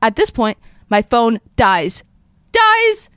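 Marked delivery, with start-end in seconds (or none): 2.5-3.1 much higher, louder outcry; dramatic cry. Real, not acted. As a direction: wail the word in mock despair. Phone dies, stuck in basement.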